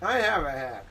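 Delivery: ordinary delivery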